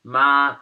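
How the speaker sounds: MA held high and level; first tone. Shown as a demonstration. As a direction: sustain "ma" loudly on one pitch. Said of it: "The first tone".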